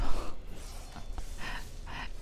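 breath-only laughing; whispered laugh. Real, not acted. Gamer amused by floating boss, whispered laugh. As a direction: laugh in a hush.

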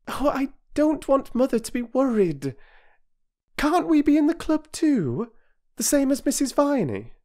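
put-on high, rising girlish pleading. A narrator's reading. Bobby pleads with the doctor.

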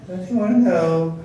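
insistent pestering. Retold or imitated. Man in traffic school nags classmate, mock-insistent. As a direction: stretch it out like a hammed-up pest. Pest insists on his question.

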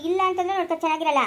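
ordinary delivery

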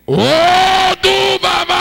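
high, sustained loud cry; prayer shout. Real, not acted. Prayer leader calling out an idol's name, high drawn-out shout. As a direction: draw out each vowel in a high shout.

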